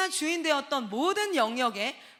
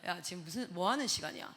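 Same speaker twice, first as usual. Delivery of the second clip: lowered stern voice; 7 semitones lower, 7 dB quieter than usual. Retold or imitated. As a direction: voice dropped low and stern. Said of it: "목소리 깔면". (목소리를 낮게 깐 엄한 호통)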